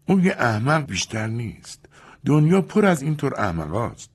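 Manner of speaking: scornful Julia. Acted. Julia to Louis about the colonel, scornful. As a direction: dismiss him as a fool.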